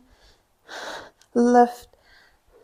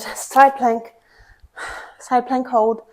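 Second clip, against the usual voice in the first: usual speaking voice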